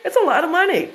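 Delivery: high, fast mock gush; mock excited echo. Echoing the grateful wife, in a high fast mock gush. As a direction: bounce her words back with playful glee. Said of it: "I'm like".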